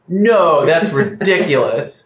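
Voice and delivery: mock-exasperated no, half laughing it off; incredulous dismissal. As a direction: brush the idea off as absurd. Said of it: "And I was like".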